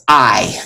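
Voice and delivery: I as one gliding vowel, like eye; single diphthong sound. Shown as a demonstration. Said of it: "just a single sound".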